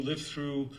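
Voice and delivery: ordinary talk, nothing marked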